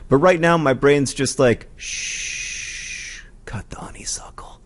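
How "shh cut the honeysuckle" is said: whispered, hushing command; shush, then whisper it like a soothing brain; hushed inner voice. Acted.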